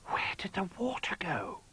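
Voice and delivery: hushed, amazed whisper; whispered gasp. Acted. Jolly whispering at the vanished water, amazed. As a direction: breathe it in wonder. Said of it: "gasped Jolly".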